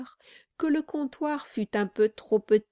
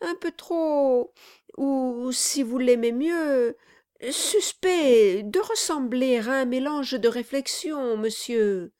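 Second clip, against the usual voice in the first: timid reply; 5 semitones higher, 3 dB louder than usual. Acted. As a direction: tiptoe around the word. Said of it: "répondit Brass ... avec timidité".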